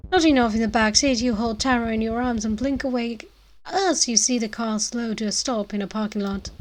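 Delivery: usual speaking voice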